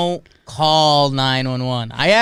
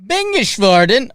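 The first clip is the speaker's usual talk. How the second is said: fake foreign language; 10 semitones higher, 6 dB louder than usual. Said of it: "whenever you do a fake language".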